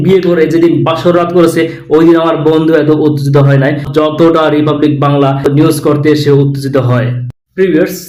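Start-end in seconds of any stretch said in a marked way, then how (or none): none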